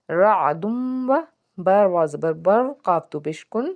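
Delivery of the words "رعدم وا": رعدم وا drawn out very slowly, nasal hum on the م; slow joined reading. Shown as a demonstration. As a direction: hold رعدم وا long and joined. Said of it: "ہجوں کے ساتھ پڑھتے ہیں".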